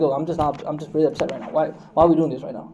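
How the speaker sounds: low, soft mock grumble; understated upset. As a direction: act out quiet frustration at home. Acting himself upset at home.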